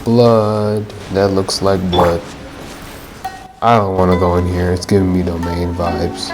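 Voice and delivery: ordinary talk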